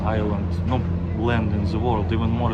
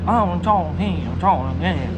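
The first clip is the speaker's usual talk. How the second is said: higher-pitched mock southern accent; 7 semitones higher than usual. Shown as a demonstration. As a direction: put on an exaggerated southern accent. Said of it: "in one hundred percent like this".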